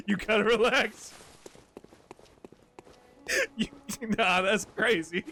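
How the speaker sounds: words breaking up with real laughter; can't get through the line for laughing; laughing while talking. Real, not acted. Streamer cracking up at a chat joke, laughing through the words.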